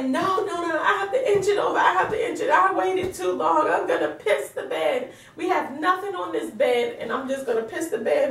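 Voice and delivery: mock-tearful wail, pleading through laughter; retold crying plea. Pregnant wife begging husband not to pull her leg, retold tearful wail.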